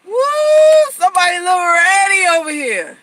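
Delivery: loud, high, hyped-up man voice; hammed-up excited man shouting; mock excited man. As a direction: whoop like a turned-on guy. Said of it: "It's like".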